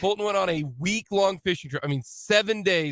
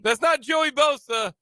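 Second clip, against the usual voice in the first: outraged shout; 8 semitones higher, slower than usual. Retold or imitated. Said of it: "I'm like".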